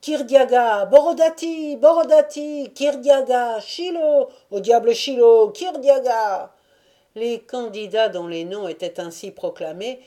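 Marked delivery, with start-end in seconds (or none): 0.0-6.5 high, loud volley of names; rival crowd shouts. A narrator's reading. Cossack factions outshouting each other, crying candidates' names.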